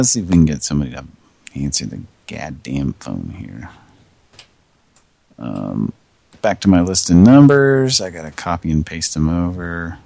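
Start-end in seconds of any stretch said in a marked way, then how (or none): none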